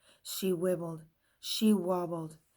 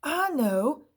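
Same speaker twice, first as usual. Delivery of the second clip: high, loud eager claim; eager eraser; 8 semitones higher, 8 dB louder than usual. A narrator's reading. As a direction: pipe up proudly. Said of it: "said Eraser".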